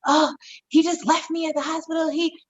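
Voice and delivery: retold upset outburst.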